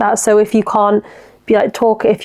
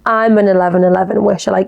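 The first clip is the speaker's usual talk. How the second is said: usual speaking voice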